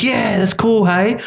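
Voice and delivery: high-pitched put-on enthusiasm; embarrassment masked by cheer. Embarrassed man answering Bella, falsely cheerful. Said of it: "I was just so embarrassed".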